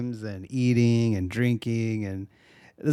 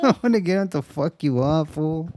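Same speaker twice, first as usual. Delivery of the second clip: higher hammed-up Chicano accent; mock Chicano accent; 4 semitones higher, 3 dB louder than usual. Woman yelling at the android, hammed-up Chicano accent. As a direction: whine about missing your vato, Chicano style.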